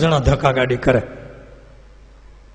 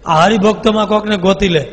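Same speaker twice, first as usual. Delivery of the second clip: higher, louder command; commanding Maharaj; 5 semitones higher, 6 dB louder than usual. Acted. Maharaj ordering the demon to pick a devotee, raised. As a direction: tell him firmly. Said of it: "તો મહારાજ કે".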